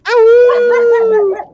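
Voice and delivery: excited whoop. Real, not acted. Reacting to howlers appearing.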